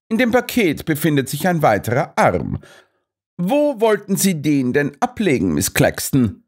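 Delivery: high, pointed questioning; triumphant Holmes. A narrator's reading. Holmes confronts Miss Claxton, high and triumphant. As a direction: corner her with the evidence.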